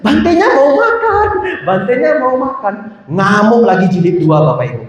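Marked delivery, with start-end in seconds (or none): 0.0-1.3 high sing-song imitation of nagging mothers; mock-nag in a very high chant; pitched way up, imitating insistent women. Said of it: "Ibu-ibunya bilang lagi". 1.7-2.7 high mock nag, less sung; lightly voiced insistent mother, pitched up; mothers nagging. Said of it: "Ibu-ibunya bilang lagi".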